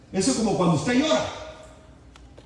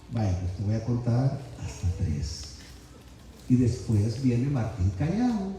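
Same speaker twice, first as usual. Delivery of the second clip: much lower, softer and slow, counting calmly; calm, controlled; 8 semitones lower, 6 dB quieter, slower than usual. Shown as a demonstration. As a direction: stay calm and measured with the child. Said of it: "contrólese, cálmese, no grite". (calmado, más bajo, lento y suave)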